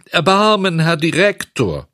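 high, wailing plea; tearful pleading. A narrator's reading. Puppet trying a better title on the director, pleading. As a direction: beg loudly for mercy. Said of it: "weinte und flehte".

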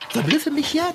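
"jetzt" thrown up high in eager impatience; eager demand. Acted. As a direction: push impatiently for it now. Insists on seeing the gloves.

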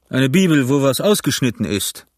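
mildly mocking pirate retort; Silver, scornful. A narrator's reading. Silver mocks Dick's cut-up Bible, scornful. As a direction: throw it back with scorn. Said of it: "antwortete Silver höhnisch".

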